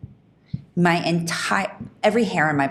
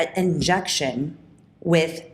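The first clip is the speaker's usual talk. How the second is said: ordinary talk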